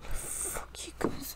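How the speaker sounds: hushed, breathy curse under the breath; whisper. Real, not acted. Viewer whispering a curse at the characters on screen. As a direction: whisper it to yourself in disgust.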